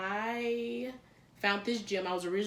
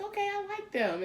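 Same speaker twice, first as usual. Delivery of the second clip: amused approval; 13 semitones higher than usual. Retold or imitated. Approving inner thought.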